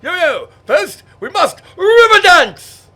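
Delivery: high, loud mock wail; shrill fake-crying aristocrat; hammed-up wailing toff. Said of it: "they said".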